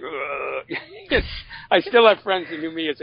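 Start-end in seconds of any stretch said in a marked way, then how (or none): 0.0-0.8 imitated groan of someone dragged out of bed; groggy morning groan. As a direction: groan softly like you hate mornings. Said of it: "I used to get up like".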